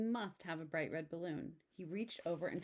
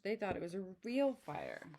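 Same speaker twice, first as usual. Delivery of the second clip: plain reading voice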